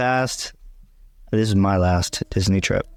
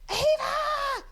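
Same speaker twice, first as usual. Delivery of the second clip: very high squealed call; excited scream; 7 dB quieter than usual. Retold or imitated. Wife reenacting her call to her daughter, high excited scream. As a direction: shriek the name in mock freak-out. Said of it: "gets really excited and screams".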